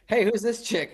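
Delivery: high mock question; lightly voiced crew member asking; a hint of a nosy reporter; curious crew guy.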